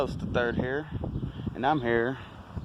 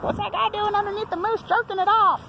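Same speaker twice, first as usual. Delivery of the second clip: high onlooker voice. Retold or imitated. Vlogger voicing staring strangers, high and scandalized. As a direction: play a shocked onlooker, pitched way up. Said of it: "They're like".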